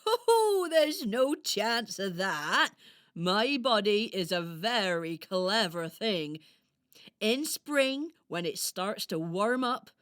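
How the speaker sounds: loud, slow storybook hedgehog; reassuring hedgehog character. Acted. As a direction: explain proudly, stretching the words. Voices Harris the hedgehog.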